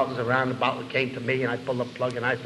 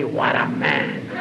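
low, slow gruff cop voice, laughing; much lower laughing cop murmur; cop's awed remark; 12 semitones lower, much slower than usual. Retold or imitated. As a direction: rumble it like an impressed old cop. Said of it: "I'd hear a cop say".

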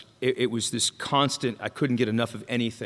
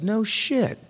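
higher-pitched blunt comeback; mock-astonished "as if I didn't know"; deadpan retort; 10 semitones higher than usual. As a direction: toss it back, unimpressed. Sponsor's blunt retort to dying.

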